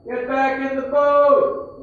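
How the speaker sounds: very loud, high, stretched-out shout; yell. Acted. Man in smaller boat calling to a swimmer, yelling. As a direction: holler it slowly across the water. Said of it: "he's gonna yell".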